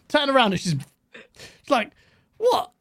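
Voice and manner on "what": high-pitched exasperated "what"; retold irritated reaction, pitched way up; annoyed. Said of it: "I got annoyed".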